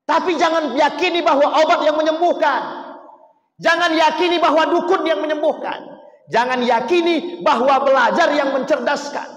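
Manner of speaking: loud, high warning from the pulpit; forceful preaching. Acted. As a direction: drive the warning home to the crowd. Preacher warns congregation forcefully.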